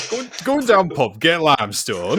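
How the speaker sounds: high-pitched start, hammed-up Yorkshire; broad comic Yorkshire, slowing down; mock Yorkshire accent.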